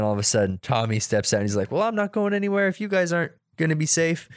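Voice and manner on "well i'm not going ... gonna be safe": high-pitched imitation of a cartoon baby; mock toddler declaration. Tommy standing by the left-out babies, high mock declaration. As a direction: pitch it up like a determined tot.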